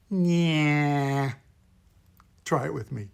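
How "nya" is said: a long, held nasal "nya"; nasal puppet sound. Shown as a demonstration. Teacher demonstrating a puppet voice's core sound, very nasal. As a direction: drone it from back of nose and throat. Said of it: "a very nasal sound".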